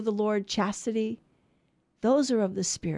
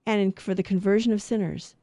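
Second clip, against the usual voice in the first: usual speaking voice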